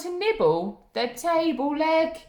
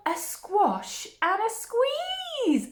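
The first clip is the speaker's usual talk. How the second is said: excited title reveal; 10 semitones higher, much slower than usual. Acted. Title read high and slow.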